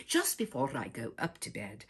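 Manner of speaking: ordinary delivery, nothing marked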